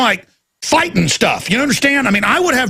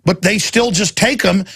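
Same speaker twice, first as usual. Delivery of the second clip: ordinary talk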